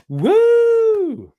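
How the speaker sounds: very high, loud whoop; excited cheer. Real, not acted. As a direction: whoop it out in delight. Cheering welcome back to chat.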